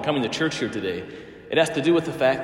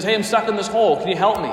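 raised, higher-pitched appeal; acted plea to a passer-by; calling up for help; 6 semitones higher, 4 dB louder, much faster than usual. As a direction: call up from the bottom of a hole. Trapped man calls up to passer-by.

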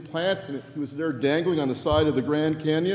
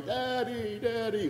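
put-on toddler in the background; 8 semitones higher, slower than usual. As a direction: coo it like a baby far off. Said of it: "one and a half year old in the background going".

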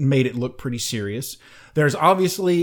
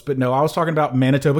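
speeding up to reveal; much faster than usual. Real, not acted. Rushes to correct before reveal.

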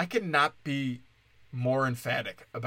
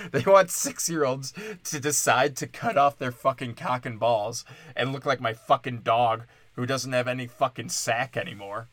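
ordinary talk, nothing marked

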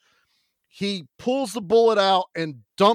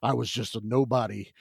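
lower, much softer brooding monotone; mock brooding hero; 8 semitones lower, 8 dB quieter than usual. Imitating the movie hero's backstory, low and soft. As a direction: mumble it like a tortured action hero. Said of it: "he's like".

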